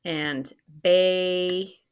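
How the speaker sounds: put-on baby said slowly, split into ba and by; slow syllable demo. Shown as a demonstration. Teacher sounding out baby slowly for the class. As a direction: stretch baby out to show both syllables. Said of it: "There's more than one syllable".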